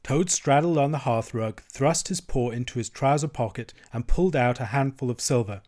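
plain reading voice

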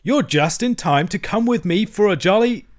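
high, jovial invitation; hearty cheer. A narrator's reading. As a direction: invite your pals along with bouncy good cheer. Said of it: "His hearty accents".

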